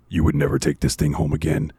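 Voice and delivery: lightly voiced ominous game text; eerie description voice. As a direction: read it like a creepy caption. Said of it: "in the voice in the description".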